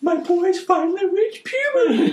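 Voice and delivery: high, slow, laughing put-on mother voice; proud clueless mother. Mother outside the bathroom misreading her son's screams, high slow squeal. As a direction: squeal it like a delighted, oblivious mum. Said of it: "she's like".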